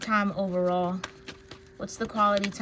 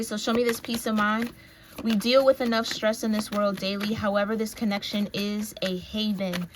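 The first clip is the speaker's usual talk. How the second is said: ordinary talk, nothing marked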